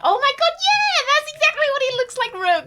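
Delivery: high loud delighted squeal; excited shriek. Real, not acted. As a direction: squeal in sudden recognition. Sudden recognition, shrieking.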